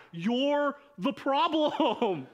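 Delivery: slow, every word weighted, with a laugh; slow punchline. Real, not acted. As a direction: land it slowly and let them laugh. Punchline of sermon point.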